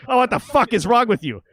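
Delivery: high, loud exasperated snap; fed up, loudly; dad snapping at the stranger. Retold or imitated. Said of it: "My dad goes".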